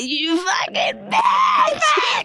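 slow drawn-out yell; mock shout. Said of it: "I'm in the garden and I'm like".

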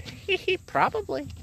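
high, giggly imitation of his mom; mock giggly mom. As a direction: tease it like a creepy cheerful mother. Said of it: "She said".

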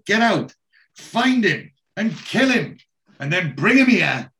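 imitating a villain ordering his heavies to kill; mock angry boss. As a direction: bark it like a furious crime boss, retold.

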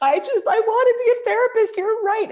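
very high, loud, tearful wail; high sobbing admission; crying confession. Retold or imitated. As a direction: blurt it out through tears. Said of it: "And I'm like".

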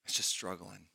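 soft, broken confession of struggle; crying out. Retold or imitated. Habakkuk confides his struggle to God, soft and weary. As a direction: let it sink low and quiet, worn out. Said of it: "He's crying out".

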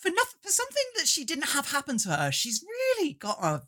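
a hint of a haughty dowager; snooty grande dame. Dowager voice ranting on the reviewer.

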